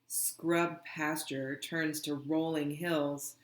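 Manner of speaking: ordinary delivery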